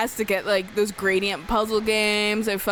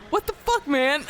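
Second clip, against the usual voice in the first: much higher, half-crying mock complaint; mock whiny disbelief; 7 semitones higher than usual. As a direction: whine it in playful outrage. Retold exasperation at friend.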